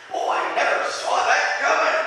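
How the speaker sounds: high, airy mock surprise from God; mocking God's surprise. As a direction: play God caught off guard, in mockery. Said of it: "Can you picture God in heaven saying".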